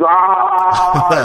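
high strained cry of pain; pained yell. Retold or imitated. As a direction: yelp as if ripping something out. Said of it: "and he's like".

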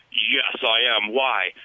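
put-on low, slow Russian-accented reply; deadpan imitation of a Russian accent. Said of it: "she had this really strong Russian accent".

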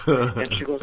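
ordinary talk

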